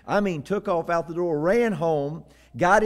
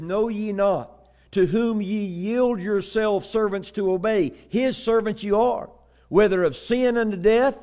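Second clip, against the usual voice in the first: raised, ringing recitation; preacher quoting scripture; 5 semitones higher, 3 dB louder than usual. Acted. Preacher quoting Paul to congregation, declaiming. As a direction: declaim the verse with force.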